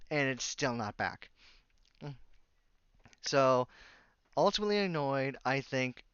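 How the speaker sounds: ordinary talk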